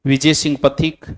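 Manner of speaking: measured note dictation with long pauses. Real, not acted. Teacher reading out notes for students to copy, dictation. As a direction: dictate phrase by phrase for students to write.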